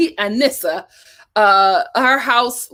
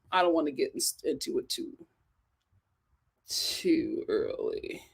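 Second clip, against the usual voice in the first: quiet; 10 semitones lower, 13 dB quieter than usual. Real, not acted. Trailing off quietly.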